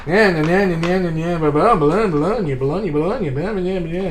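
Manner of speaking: mock the endless repetition, bouncing up and down; mocking repetitive yammer. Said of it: "just like over and over again".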